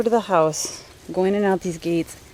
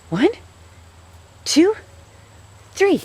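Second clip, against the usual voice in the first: high, louder playful count; sing-song countdown; 11 semitones higher, 4 dB louder, slower than usual. Real, not acted. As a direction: tease the treat out high. Counting down a treat again.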